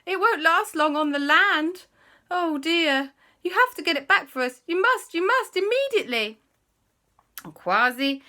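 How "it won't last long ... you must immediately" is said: high, loud, fast alarm; panicked queen. Acted. Queen panicking about the ring on land, high and loud. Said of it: "the Queen said".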